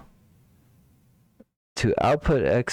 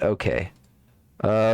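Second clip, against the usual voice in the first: usual speaking voice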